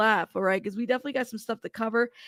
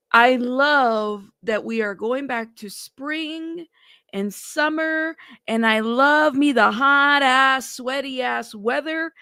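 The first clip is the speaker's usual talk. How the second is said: slow; 3 dB louder, slower than usual. Real, not acted. Drawn-out praise of summer.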